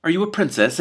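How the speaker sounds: hopeful dragon. A narrator's reading. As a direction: ask eagerly like a hopeful young dragon. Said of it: "it asked hopefully".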